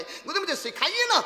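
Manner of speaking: humble plea. Acted. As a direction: beg the guru earnestly. Pleading to guru. (गुरु से विनती करती ऊँची आवाज़)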